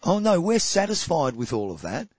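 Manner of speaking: ordinary talk, nothing marked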